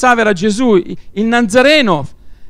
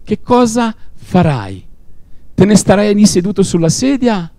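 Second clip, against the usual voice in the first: ordinary delivery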